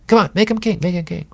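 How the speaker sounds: high, coaxing tempter voice; a sly devil urging the crowd on; Satan egging on the crowd. Retold or imitated. Preacher voicing Satan urging the crowd, high and coaxing. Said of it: "I can almost hear Satan in the crowd going".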